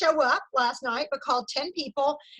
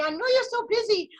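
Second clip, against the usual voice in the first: lightly put-on Spanish accent, a touch higher; a faint, playful Spanish accent; mock Spanish accent; 6 semitones higher than usual. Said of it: "I'm working on my Spanish accent".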